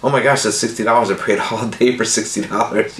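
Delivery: retold thrill at finding the sixty dollars; excited disbelief. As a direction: burst out in amazed delight. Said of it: "I went".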